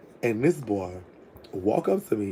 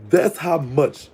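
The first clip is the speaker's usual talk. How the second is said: ordinary delivery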